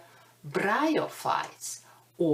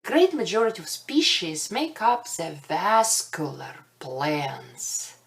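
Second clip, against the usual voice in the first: usual speaking voice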